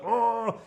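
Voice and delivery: a touch of a hammed-up ogre groan; mock ogre grunt. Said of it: "big ogre who's like".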